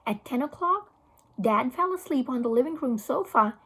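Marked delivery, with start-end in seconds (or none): none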